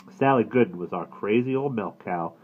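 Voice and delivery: plain reading voice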